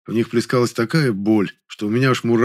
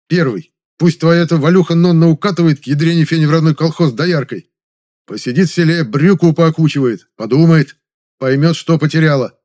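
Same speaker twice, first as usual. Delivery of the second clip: loud, raised, forceful lecture; barked orders; 6 semitones higher, 6 dB louder than usual. A narrator's reading. Man lays out options for moping friend, barking. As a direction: lay down the law, loud and emphatic. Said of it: "И я не выдержал и рявкнул".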